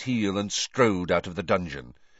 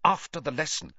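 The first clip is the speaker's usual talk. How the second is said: sharp, higher snap; snapping; 7 semitones higher than usual. Acted. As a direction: bite the words off. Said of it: "Snape snapped".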